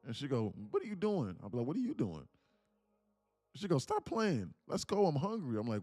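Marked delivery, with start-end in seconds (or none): 0.7-1.4 high, puzzled imitation of his girlfriend. Girlfriend stopped at the door, high confused question. 1.7-2.3 higher echo of her words, teasing; mimicking her back. He mocks his girlfriend by repeating her, teasing echo. 3.8-5.6 high, louder impatient whine; impatient girlfriend. Retold or imitated. Girlfriend at the restaurant door, high impatient whine.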